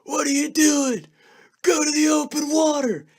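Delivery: high, loud mock yell at me; high screaming from the railing; retold scream. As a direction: yell it like Tex from the boat's edge. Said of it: "he's screaming at me".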